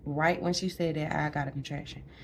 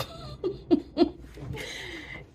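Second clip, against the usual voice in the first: breathy laugh. Real, not acted. Laughing at own joke.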